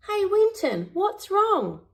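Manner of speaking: put-on high, bright-eyed young pup voice; Bluey's voice. A narrator's reading. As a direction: lift into a little kid's voice, concerned. Narrator voicing Bluey again.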